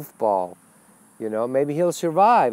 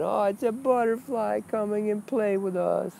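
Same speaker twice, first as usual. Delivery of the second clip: much higher, airy mock-naive voice; dreamy spiritual innocent; 8 semitones higher, 4 dB quieter than usual. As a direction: play a wide-eyed seeker marveling at a butterfly. Mocking a naive spiritual seeker.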